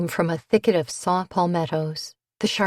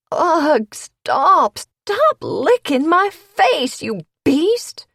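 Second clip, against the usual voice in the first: high, loud, slow protest; groaning and pleading; 10 semitones higher, 6 dB louder, much slower than usual. A narrator's reading. Gabby pushing off a licking dog, loud groaning plea. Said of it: "groaning and pleading".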